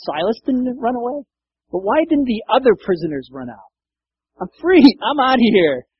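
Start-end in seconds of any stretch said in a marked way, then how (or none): 4.4-5.9 high, loud excited cry of freedom; gleeful escape. Retold or imitated. Prisoner bolting from the opened jail, loud and high. Prisoners voiced after earthquake.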